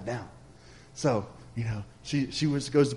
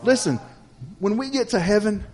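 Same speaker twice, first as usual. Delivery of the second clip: higher, driving sermon delivery; earnest preaching; 4 semitones higher, 4 dB louder than usual. Real, not acted. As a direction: preach it with rising conviction. Preaches the point home after story.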